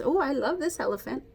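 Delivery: usual speaking voice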